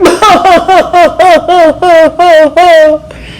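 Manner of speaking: hammed-up wailing laugh; high mock sob-laugh. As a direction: let out a high comic wail. After crying together.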